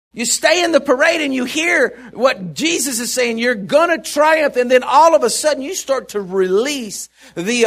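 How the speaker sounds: soaring sermon climax; full-force preaching. Acted. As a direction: lift them up with conviction.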